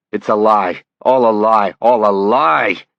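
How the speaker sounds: loud desperate denial, slowing; shouting. Acted. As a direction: yell it out, last one drawn out. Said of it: "Dussault screamed at him".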